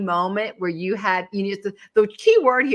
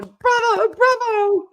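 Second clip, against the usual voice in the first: mock audience cheer; 14 semitones higher, 4 dB louder, much slower than usual. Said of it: "they're just going".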